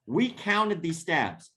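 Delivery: usual speaking voice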